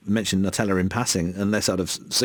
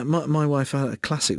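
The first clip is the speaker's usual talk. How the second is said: ordinary talk, nothing marked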